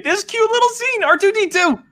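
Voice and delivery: high-pitched, cutesy gush. Real, not acted. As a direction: squeal it over something adorable. Gushing over a cute scene.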